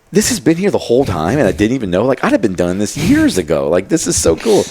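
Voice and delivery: high, loud burst of amazement; excited disbelief. Retold or imitated. A man retelling finding an open mic nearby, amazed. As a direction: exclaim it like a discovery.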